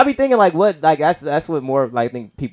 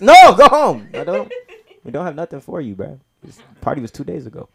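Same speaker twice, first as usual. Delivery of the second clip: mom yelling, then fading; 6 semitones higher, 10 dB louder than usual. Retold or imitated. Mom sending away a guest who came days late. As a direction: shout it, then mutter the rest off.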